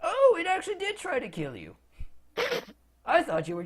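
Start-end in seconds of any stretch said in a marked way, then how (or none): 0.0-1.8 high-pitched surprised realization; oh-so-it-really-did astonishment; surprised wizard. Acted. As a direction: pitch it high, as if only now believing them.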